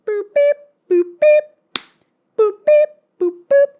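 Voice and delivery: very high, loud beeping sound effect; teleport noise. Retold or imitated. Mirror teleport imitated as high loud beeps. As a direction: squeak out a magic teleport.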